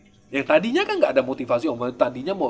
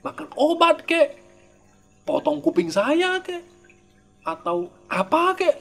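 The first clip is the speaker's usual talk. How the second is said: eager inner voice; 8 semitones higher than usual. Retold or imitated. Voicing eager practitioner's thoughts.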